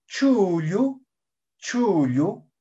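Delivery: "Chuyu" with the first u drawn out; lengthened vowel. Shown as a demonstration. Teacher showing Quechua learners a word, lengthened vowel. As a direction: hold the long u in "chuyu". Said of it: "produce un alargamiento en algunas zonas".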